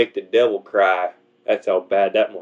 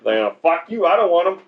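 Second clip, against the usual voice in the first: mock squabbling devil; 6 semitones higher than usual. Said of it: "He's like".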